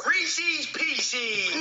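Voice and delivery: high-pitched "Reese's Pieces" as "reesees peesee"; mispronounced candy name. Acted. Kid echoes the wrong candy name, high mispronunciation. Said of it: "you had the Reese's part right".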